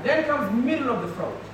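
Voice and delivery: usual speaking voice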